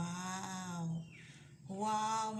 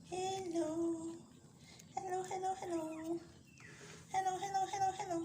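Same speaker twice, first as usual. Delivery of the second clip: high sing-song calling; cooing hello; 11 semitones higher, faster than usual. Real, not acted. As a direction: coax them out with high hellos. Calling to hiding baby animals.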